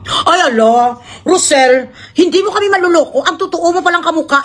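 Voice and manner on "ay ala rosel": ordinary talk, nothing marked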